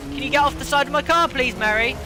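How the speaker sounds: sarcastically polite plea to the AI car; mock-polite asking Mary to move; exasperated plea. Real, not acted. Pleading at AI car.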